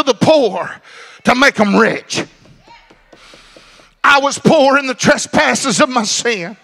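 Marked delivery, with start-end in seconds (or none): none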